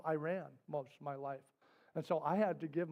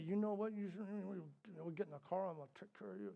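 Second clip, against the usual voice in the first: hushed mock threat; old-me threat; 9 dB quieter, faster than usual. Old me to daughter in Safeway, hushed threat. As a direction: drop to a very soft, menacing undertone. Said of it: "The old me would just say".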